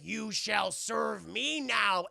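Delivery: slow, stretched villain order; mock imperious command. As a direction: lord it over a captive, word by word. Demona commands the captive elf.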